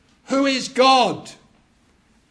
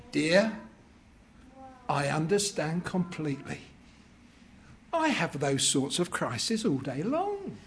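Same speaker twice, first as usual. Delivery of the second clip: low, soft patient wife; calm understanding wife; 6 semitones lower, 7 dB quieter than usual. Acted. Wife calmly answering her husband, lower and softer. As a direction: settle him gently. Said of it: "the wife looks at the man and says".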